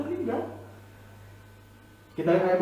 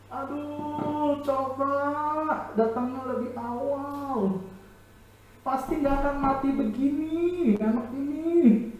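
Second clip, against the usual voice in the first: higher, soft, slow lament; lamenting mourner; 6 semitones higher, 4 dB quieter, slower than usual. Acted. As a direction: grieve aloud for the lost child. Mourners lamenting the child's death.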